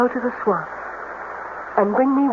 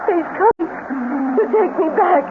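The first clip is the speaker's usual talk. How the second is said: fast, frightened rush building to a sob; terrified; 3 semitones higher than usual. Acted. Udine panicking to Larry that the swamp man is coming, terrified cry.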